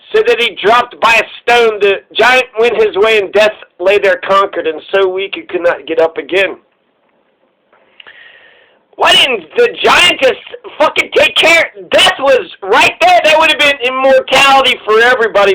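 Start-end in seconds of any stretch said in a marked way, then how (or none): none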